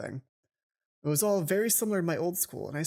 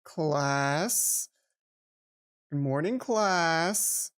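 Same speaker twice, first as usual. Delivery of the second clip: a hint of a teacher, drawn-out slow greeting; lightly voiced teacher; much slower than usual. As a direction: greet the class like a gentle teacher, slowly. Voicing the teacher greeting class.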